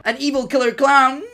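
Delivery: high, loud sinister announcement; villainous clown voice; evil killer clown. Pretend. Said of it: "that's my killer clown laugh".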